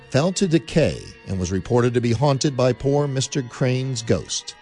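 normal talking voice

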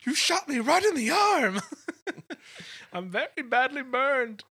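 very high, loud, laughing whine; Mustafa quote. Retold or imitated. Host quoting Will Ferrell's Mustafa, laughing whine. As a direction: whine like a burned henchman. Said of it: "you have the quote for".

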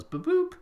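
mock electronic boop, second one squeaky high; dock beeps. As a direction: imitate the dock going boop boop. Imitating bike dock beeps.

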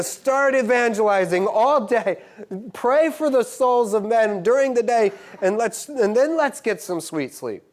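loud, high, fervent preaching; preaching at full force. Acted. Preacher rousing the congregation, loud and high. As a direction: lift the room with it.

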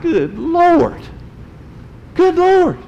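high, squealing exclamation of shock; shocked Good Lord. Retold or imitated. Preacher reliving seeing the swollen puppy, shocked squeal. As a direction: gasp it out high in disbelief.

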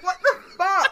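high, loud outburst through laughter; laughing disbelief. Retold or imitated. Retelling a reaction to the cartoon's underwater gag, laughing in disbelief. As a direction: crack up while blurting it out.

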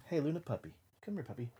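gentle puppy call; soft dog talk. Real, not acted. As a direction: coax the dog softly. Calling to snoring dog.

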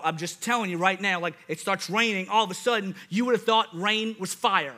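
ordinary talk

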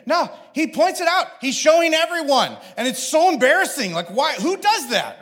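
worked-up, scandalized complaint to dad; exasperated kid. Retold or imitated. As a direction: imitate a mortified brother ranting. Acts out son's outraged complaint.

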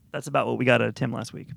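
normal talking voice

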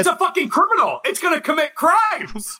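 fast, high-pitched rant; loud sharp voice. Real, not acted. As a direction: blurt it out heated. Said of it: "Why are you yelling at us?".

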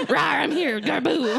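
loud mock monster roar; dinosaur voice. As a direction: rawr it like a playful monster. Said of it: "You just sounded so scary".

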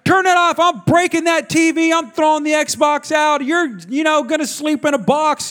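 acted parental tirade; mad parent threatening. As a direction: rattle off wild threats like a fed-up dad. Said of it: "saying random things 'cause you're mad".

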